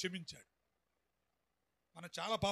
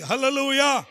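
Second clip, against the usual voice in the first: hallelujah shouted out with hands raised; loud hallelujah; 3 semitones higher, 14 dB louder than usual. Real, not acted. Preacher leading everyone to say it loudly. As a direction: lift the church with one loud cry. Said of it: "చెబుదాం గట్టిగా అందరం".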